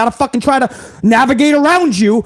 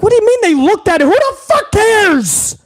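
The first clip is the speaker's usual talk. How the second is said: high, fast then dragged-out disbelief; incredulous outburst; 9 semitones higher than usual. Retold or imitated. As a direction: pitch it up in outraged disbelief. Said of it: "And I'm like".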